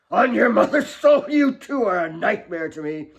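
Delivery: high, loud despairing roar; father raging at his quarrelling daughters; anguished rage. Acted. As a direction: cry out in pained fury. Father raging after slap.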